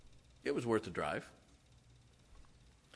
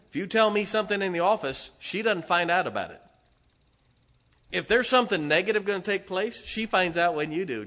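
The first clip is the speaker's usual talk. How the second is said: louder, higher pulpit push; preaching; 6 semitones higher, 5 dB louder than usual. Acted. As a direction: press the point home to the congregation. Preaching rises again.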